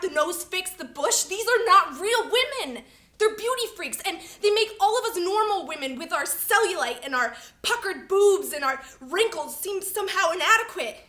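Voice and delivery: loud, high, building indignation; angry tirade. Acted. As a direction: rattle off the list and build to outrage. Escalating tirade against beauty standards.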